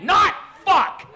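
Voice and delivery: high, loud mock outburst; flustered dad yell. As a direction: yell a defensive denial at the kid. Dad snaps back at child.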